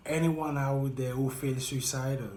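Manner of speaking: ordinary talk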